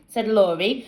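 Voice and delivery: ordinary delivery, nothing marked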